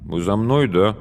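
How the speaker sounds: ordinary delivery, nothing marked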